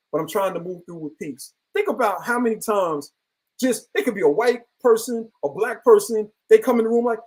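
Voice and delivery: usual speaking voice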